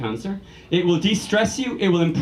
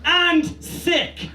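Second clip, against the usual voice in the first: mock ad voice; 5 semitones higher, slower than usual. Reading ads aimed at women.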